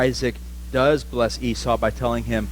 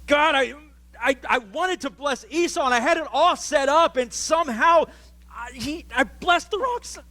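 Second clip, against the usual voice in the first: a higher, pleading Isaac praying to God; anguished prayer; 10 semitones higher than usual. Retold or imitated. Isaac confessing to God he blessed the wrong son, higher voice. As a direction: plead with God, a touch distraught. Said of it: "where it's like".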